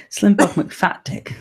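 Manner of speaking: laughing reading. Real, not acted.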